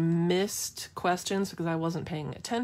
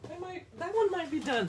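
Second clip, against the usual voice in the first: giddy high squeal; 11 semitones higher than usual. Real, not acted. Delighted by her painting.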